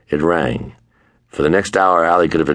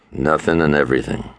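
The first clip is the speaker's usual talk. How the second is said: plain reading voice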